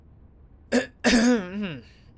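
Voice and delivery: loud, pointed clearing of the throat; awkward throat clearing. Acted. Friend changing the subject, awkward throat clearing. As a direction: clear the throat to break the silence. Awkward pause after grim topic.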